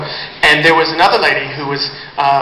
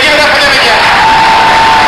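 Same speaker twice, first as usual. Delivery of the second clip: loud, slow, higher shout rallying applause; calling out to the crowd; 7 semitones higher, 4 dB louder, slower than usual. Real, not acted. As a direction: stretch it out and hype the crowd up.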